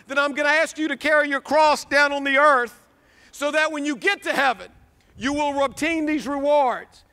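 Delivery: usual speaking voice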